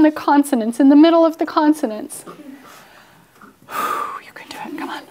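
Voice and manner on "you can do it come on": whispered pep talk to herself, mock-dramatic; self-encouraging whisper. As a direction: psych yourself up under your breath.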